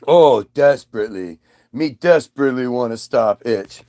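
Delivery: low dopey simpleton; dim Eve. Retold or imitated. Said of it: "Eve answers snake".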